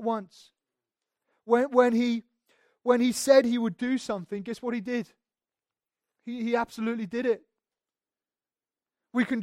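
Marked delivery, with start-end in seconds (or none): none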